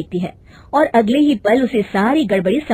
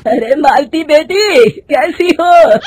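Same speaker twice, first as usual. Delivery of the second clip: put-on higher, louder, slow old man's call; 7 semitones higher, 7 dB louder, much slower than usual. Old clerk calls out to Malti in the market, slow loud old man. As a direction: call out to her across the bazaar.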